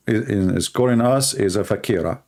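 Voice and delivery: ordinary talk, nothing marked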